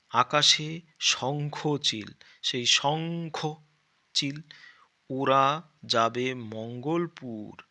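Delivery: lightly recited textbook lines; reading aloud. Acted. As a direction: read the lines out slowly like a rhyme.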